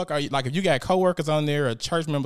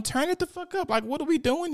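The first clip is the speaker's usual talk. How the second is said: squealing hype. Real, not acted. To viewers, repeating her urging in a high excited squeal. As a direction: shoot way higher, squealing it out.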